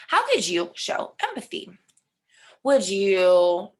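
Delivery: ordinary talk, nothing marked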